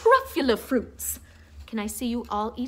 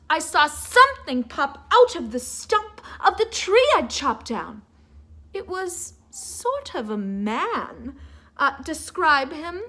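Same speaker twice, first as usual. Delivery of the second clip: ordinary delivery, nothing marked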